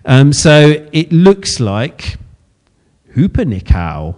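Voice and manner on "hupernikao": hupernikao said low and careful, hoo-per-nee-kow; tentative Greek pronunciation. Shown as a demonstration. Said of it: "I'm going to try and pronounce the Hebrew".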